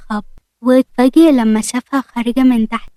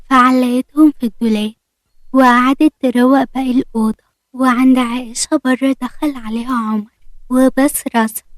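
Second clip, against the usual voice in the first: usual speaking voice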